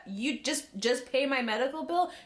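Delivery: mock incredulous. She imagines that request in America, mock incredulous. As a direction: repeat it with rising disbelief.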